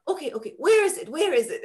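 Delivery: put-on high, quick little kid voice; a four-year-old fussing over his teacher; high, hurried preschooler asking; child's voice. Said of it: "sat her down and said".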